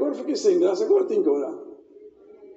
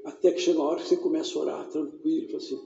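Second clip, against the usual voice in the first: lower, softer intimate sermon voice; quiet intimate pulpit talk; soft low preaching; 8 semitones lower, 4 dB quieter than usual. Acted. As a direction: bring it down close and gentle.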